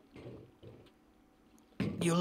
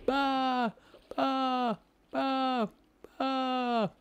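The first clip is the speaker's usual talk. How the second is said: higher, soft begging whimper; pleading dying fish; 6 semitones higher, 5 dB quieter, slower than usual. Acted. Dying fish begging the whale for mercy. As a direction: beg for your life, fading out.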